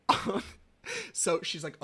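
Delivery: ordinary talk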